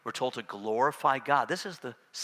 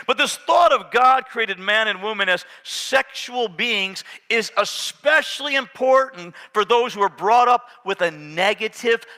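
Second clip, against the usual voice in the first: booming sermon delivery; full-force preaching; 10 semitones higher, 11 dB louder than usual. Acted. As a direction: preach it out to the whole church.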